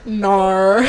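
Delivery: loud exaggerated Australian "no"; Australian accent. Retelling how the Australian girl said no, Australian accent. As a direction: draw out an Aussie "naur". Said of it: "I also like Australian accent".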